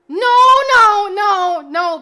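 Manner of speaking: loud pleading no's; anguished shouting refusal; desperate no. Real, not acted. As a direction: cry out no again and again. Begging chat to reveal identity.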